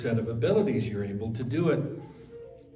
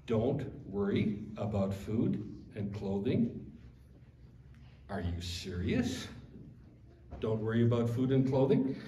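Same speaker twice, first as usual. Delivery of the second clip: low, hushed incredulous questioning with rising ends; mock inner disbelief; 4 semitones lower, 6 dB quieter than usual. Preacher voicing the listener's inner protest at the teaching, hushed disbelief. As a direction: query it softly, as if you can't believe it. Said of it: "this thing that kinda screams out in your head".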